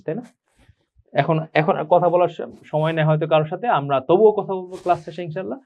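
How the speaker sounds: ordinary talk, nothing marked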